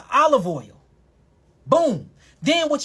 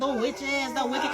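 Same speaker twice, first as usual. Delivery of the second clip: mock witch voice; 3 semitones higher, 7 dB quieter than usual. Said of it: "Sound like the wicked witch".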